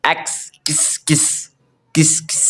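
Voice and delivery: "किस" breathed out without voice; whispered X sound. Shown as a demonstration. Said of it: "एक्स का जो basically sound होता है". ("किस" फुसफुसाकर)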